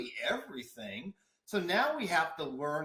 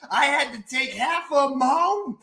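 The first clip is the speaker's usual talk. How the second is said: higher and louder, building excitement; excited retelling; 9 semitones higher, 6 dB louder than usual. Real, not acted. Guest reliving the fight over pages, louder and higher. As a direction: tell it like the chaos is happening again.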